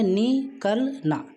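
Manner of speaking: निकलना drawn out very slowly; slow demo. Shown as a demonstration. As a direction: spell out निकलना by sound. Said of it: "तो इसमें लगाने की कोई ऐसी ज़रूरत भी नहीं है". (निकलना को बहुत धीरे बोलें)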